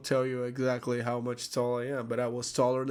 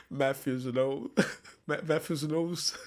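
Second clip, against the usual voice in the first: laughing. Real, not acted. Laughing at funny translated name.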